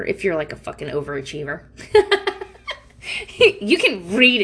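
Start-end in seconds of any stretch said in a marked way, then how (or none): 1.7-2.5 short high burst of laughter; bright laugh. Real, not acted. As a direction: laugh out loud. Laughs at own joke.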